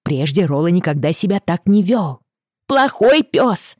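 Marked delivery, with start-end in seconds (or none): 2.7-3.8 scolding. Acted. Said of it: "ругалась Рэйчел".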